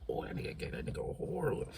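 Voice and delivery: whispered, fast and awed; whispering to a friend at the fight; hushed aside. Retold or imitated. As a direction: breathe it out under your breath. Aside while watching the punch.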